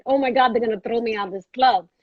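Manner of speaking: ordinary delivery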